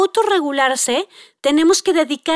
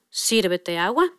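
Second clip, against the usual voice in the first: lower, softer, slow calm instruction; calm mom; 7 semitones lower, 5 dB quieter, slower than usual. Retold or imitated. Patient mother telling her son to serve himself, calm and slow. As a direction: patiently invite him to help himself.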